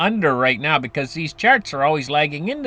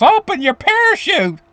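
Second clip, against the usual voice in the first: much higher, mock-frantic call; retold urgent yell; 11 semitones higher than usual. Jumper warns his friend closer to the ground, high mock yell. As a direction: act out yelling down as the ground nears. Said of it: "The guy yells".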